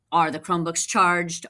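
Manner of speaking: ordinary delivery, nothing marked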